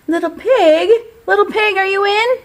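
high sing-song call at the door. Retold or imitated. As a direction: call out to the pig. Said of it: "so I called".

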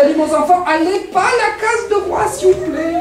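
high-pitched plea climbing steeply; pleading mother. Acted. Mother begging her child to avoid the king's house, pleading. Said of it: "la maman de Tijan".

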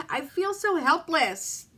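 breaking on "so helpless"; crying. Real, not acted. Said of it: "sit here and cry on camera".

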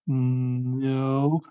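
drawn-out, hummed start to "no"; coy denial. Acted. Olivia answering her worried parents, coy and drawn-out. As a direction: stretch the word out slyly.

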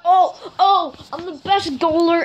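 high-pitched gamer elation; freaking out. Real, not acted. Player boasting after scoring a goal, excited and high. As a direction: crow excitedly as if you just scored. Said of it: "I'm freaking out here".

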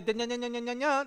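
imitating foreign chatter with nya nya; mock Chinese chatter. Mimicking the crowd's chatter to the congregation, light babble. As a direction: babble nonsense syllables to mimic them. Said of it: "they're talking to each other".

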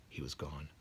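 ordinary delivery, nothing marked

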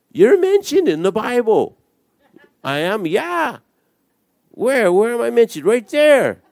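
high, eager back-and-forth; excited mock exchange. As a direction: pitch it high, like delighted news. Acted teasing exchange with a scoffer.

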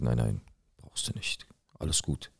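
whispered, voice dropped right down; hushed whisper. Retold or imitated. To his neighbour, turning down payment: soft whisper. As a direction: murmur it low so only he hears. Waves off paying, hushed aside.

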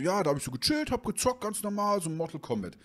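high, sheepish voice of the buddy; nervous friend. Retold or imitated. Friend telling the owner about the broken game, high and flustered.

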